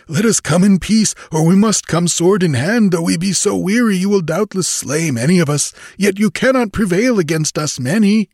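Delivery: high, insistent pirate voice; clamoring. A narrator's reading. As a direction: argue loudly for the whole band. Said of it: "clamored a lean Shemite".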